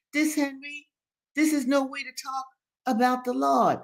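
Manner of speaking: put-on high-pitched old man's reproof; reproving Daddy Joe. A narrator's reading. Old man corrects Henry about the Lord, reproving. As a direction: scold in a high, halting voice.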